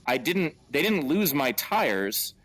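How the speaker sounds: spelling it out slowly, baffled; comic over-explains, slow and baffled. Retold or imitated. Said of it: "I'm like".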